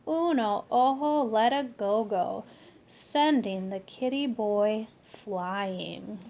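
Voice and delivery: usual speaking voice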